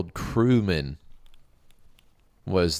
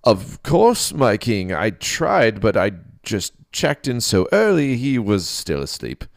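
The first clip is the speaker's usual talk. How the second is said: eager nervous priest; 5 semitones higher, 8 dB louder, slower than usual. Acted. Priest plays along with king.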